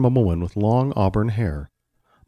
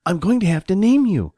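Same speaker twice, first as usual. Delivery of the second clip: put-on high-pitched child voice; playful little boy; 9 semitones higher, 4 dB louder than usual. Johnny talks to his new friend, playful child voice. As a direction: chatter like a small boy to a toy.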